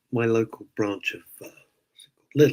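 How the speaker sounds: usual speaking voice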